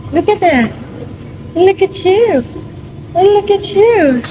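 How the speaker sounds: high, excited cooing. Real, not acted. Cooing at baby in pool. (mataas at masiglang boses-bata)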